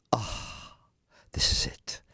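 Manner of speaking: soft, airless gasp of anticipation; hushed, excited whisper; breathy hush. Retold or imitated. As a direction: whisper it as the phone rings.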